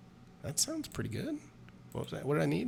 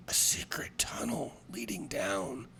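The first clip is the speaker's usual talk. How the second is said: slow whispered secret; 6 dB quieter, slower than usual. Acted. Drunk Wendell shares his secret, whispering slowly. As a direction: lean in and whisper it.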